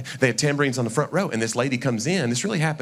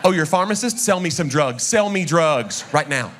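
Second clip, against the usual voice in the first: higher, insistent demands building louder; mock pushy demand; 5 semitones higher, 4 dB louder than usual. Pestering a pharmacist to prove himself, mock demanding. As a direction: badger them, climbing to a mock yell. Mimics people demanding proof on the spot.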